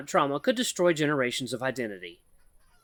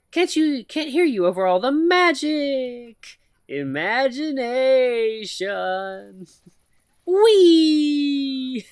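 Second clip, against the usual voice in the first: mock mystical magic; 12 semitones higher, 7 dB louder, much slower than usual. AI keeps teasing with magic.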